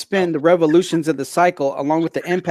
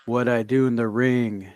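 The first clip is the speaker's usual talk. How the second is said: low, halting promo delivery with pauses between phrases; choppy wrestling promo; 4 semitones lower than usual. Acted. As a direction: cut a promo in slow, measured chunks. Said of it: "choppy like that".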